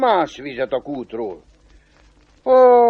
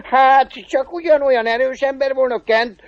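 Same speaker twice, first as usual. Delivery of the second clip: high-pitched devil, laughing first; cackle, then ask in a high devil voice; chuckling devil; 9 semitones higher than usual. Acted. Devil asking the cobbler about his strength, high and amused.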